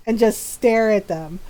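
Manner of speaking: plain reading voice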